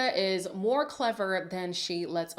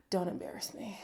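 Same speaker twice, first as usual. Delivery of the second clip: whispering; 4 semitones lower, 8 dB quieter than usual. Pretend. Pleading aside to the cast.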